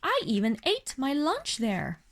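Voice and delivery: plain reading voice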